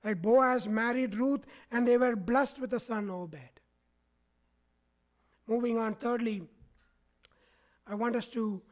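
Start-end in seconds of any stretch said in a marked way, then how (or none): none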